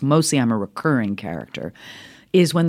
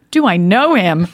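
higher, louder retort; mock incredulous; 6 semitones higher, 6 dB louder than usual. Actress answering whether she knows her old friend, incredulous. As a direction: throw it back as if absurd.

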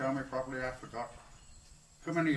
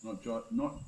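ordinary talk

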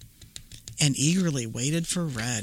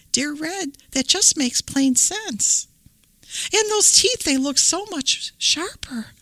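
put-on little girl voice; 10 semitones higher than usual. Red questions granny.

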